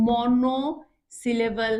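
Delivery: "Monosyllable" very slow, as মনো, syllable; term in pieces. Shown as a demonstration. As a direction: draw out "monosyllable" with a break after মনো. Teacher spells out new term. ("Monosyllable" ধীরে, ভেঙে ভেঙে)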